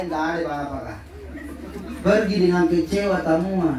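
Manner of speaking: usual speaking voice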